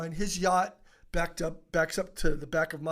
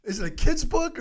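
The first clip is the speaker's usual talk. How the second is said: high, loud mock disbelief; incredulous question; 10 semitones higher, 7 dB louder than usual. Teacher doubting the author's book, high and loud. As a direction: ask it as if it must be a joke.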